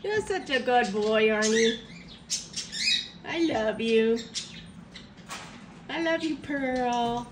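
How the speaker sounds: low tender cooing; talking to a dog. Real, not acted. Tender praise to pets.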